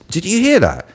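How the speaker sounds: retold amazed question, pitched high; astonished. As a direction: ask your friend in high-voiced disbelief. Astonished question to his friend, retold.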